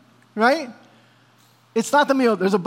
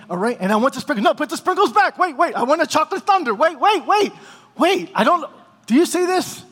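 anxious customer; 3 semitones higher than usual. Acted. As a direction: panicky indecision at the donut counter. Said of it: "It's this anxiety over making decisions".

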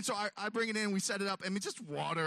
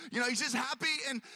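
ordinary delivery

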